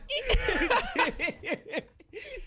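helpless squeaky laughter; high squealing laugh. Real, not acted. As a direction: crack up high.

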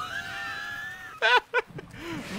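long high-pitched giggle; squealing embarrassed laughter. Real, not acted. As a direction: laugh high at your own fright. Laughs at own scream.

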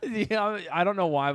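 ordinary talk

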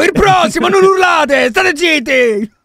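high, very loud mimicked bellow; mock tavern yelling. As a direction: imitate a rowdy tavern hawker yelling. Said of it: "Sta urlando davanti a tutta".

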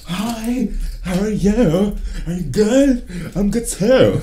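put-on high, loud, giddy drunk chatter; mock drunk pickup line; silly drunk greeting. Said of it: "It's, like, stupid like that".

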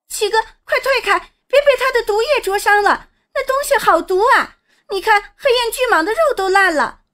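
put-on high, fast, urgent girl's voice; alarmed warning. A narrator's reading. Sister urges brother back from the venom, high and alarmed. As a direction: warn urgently, words tumbling out high.

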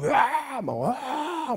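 high, drawn-out "waah" yell; mock battle yell. Preacher mimics Goliath yelling across empty ground, mock yell. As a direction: imitate a bellowing braggart from afar. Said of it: "이러면서 소리를 지르고 있는 거예요".

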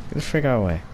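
usual speaking voice

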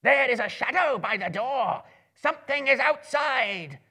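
high, loud goblin alarm; a goblin shouts the alarm to the others, high and loud. A narrator's reading. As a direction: raise the alarm, slowing on something is outside. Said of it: "one of the goblins inside shouted".

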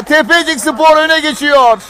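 ordinary talk

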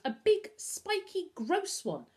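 high, slow, disgusted listing; appalled. A narrator's reading. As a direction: savour each revolting word. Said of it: "Novak was appalled".

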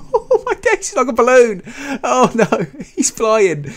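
cracking up at the sight; laughing hard. Real, not acted. Dino floats like a balloon.

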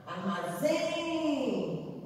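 Armazém with ZÉM high and long; stress on last syllable. Shown as a demonstration. Teacher showing the tonic syllable, ZÉM drawn out. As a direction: draw out the final "zém" of "Armazém". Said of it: "o Zém".

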